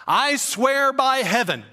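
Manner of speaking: high, slow, emphatic swearing; mock solemn oath. Teen denies the deed to his dad, mock solemn oath. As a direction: proclaim it like an overdone oath.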